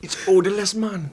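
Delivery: insistent put-on Jamaican patois; fake Jamaican accent. Guest defending his herb to the host, fake Jamaican accent. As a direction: play a protesting Jamaican, broad accent. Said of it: "this is not your real accent".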